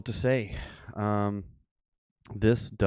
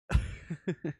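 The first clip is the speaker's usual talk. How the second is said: laugh; 9 semitones higher than usual. Real, not acted. Laugh after scolding son.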